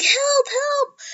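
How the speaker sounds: sharp, very high cry for help; high alarmed yelp; calling for help. Retold or imitated. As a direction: call out urgently to save her. Calling out for trapped Uraka.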